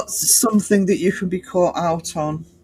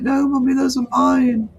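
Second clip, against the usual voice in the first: higher-pitched, whiny pet voice; whining dog; 8 semitones higher, 4 dB louder than usual. Retold or imitated. Dog pleading with Mummy over its treats, whining. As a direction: whine it like a possessive dog. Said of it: "head cocked and whining".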